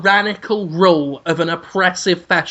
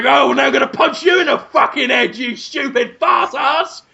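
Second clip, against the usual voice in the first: mock angry shout; 5 semitones higher than usual. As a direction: bellow the threat like a thug. Policeman threatening, shouting.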